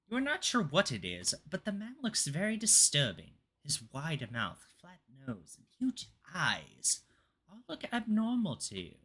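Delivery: dramatic spooky reading; story narration. Acted. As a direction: read it like a creepy tale. Reads the next note aloud.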